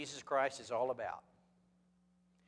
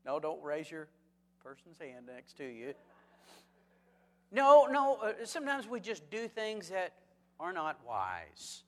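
ordinary talk, nothing marked

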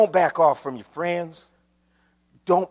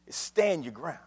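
understated, lowered-voice counsel; quiet instruction; 6 dB quieter than usual. Real, not acted. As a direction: say it softly and let it sink in. First quiet take of phrase.

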